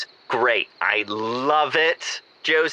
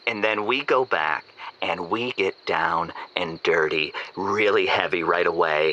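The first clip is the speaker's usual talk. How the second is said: ordinary delivery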